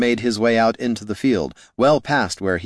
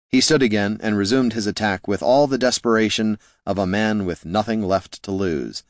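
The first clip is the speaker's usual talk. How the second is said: plain reading voice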